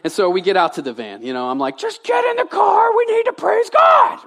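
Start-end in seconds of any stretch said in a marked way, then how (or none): 1.8-4.2 very high, loud comic screech; re-enacted parental outburst, pitched way up; yelling at the kids. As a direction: yell like a frazzled parent, hammed up. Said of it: "I yelled at them".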